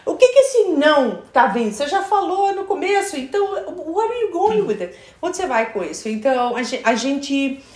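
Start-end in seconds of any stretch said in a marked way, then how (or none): 0.0-6.1 high-pitched, animated confusion; hammed-up bewilderment, pitched way up; baffled listener. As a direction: act out someone totally lost by the question. Acting out baffled English listeners.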